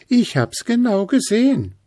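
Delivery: Stachelchen contradicting. A narrator's reading. Stachelchen contradicts Gruseli, high and bristly. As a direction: bristle back at him. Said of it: "sagte Stachelchen".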